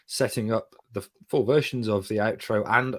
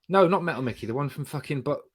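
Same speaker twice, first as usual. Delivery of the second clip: ordinary talk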